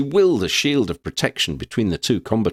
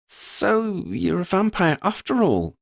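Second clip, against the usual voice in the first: put-on much higher, slow, amused young voice; smiling Lydia; 11 semitones higher than usual. A narrator's reading. Lydia teases Verdi about being a vampire, smiling. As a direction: say it with a knowing smile. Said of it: "Lydia smiled".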